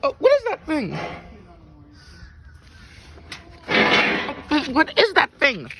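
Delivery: high, loud, startled question; shocked outcry. Acted. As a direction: cry out in alarm at a strange creature. Startled by the strange new pet.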